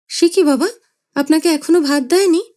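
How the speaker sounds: startled concern for his father; surprised son. Acted. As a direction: son taken aback.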